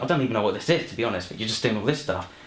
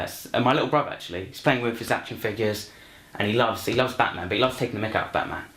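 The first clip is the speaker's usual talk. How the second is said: ordinary talk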